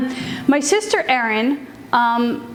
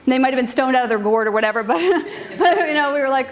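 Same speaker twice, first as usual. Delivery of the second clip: ordinary delivery, nothing marked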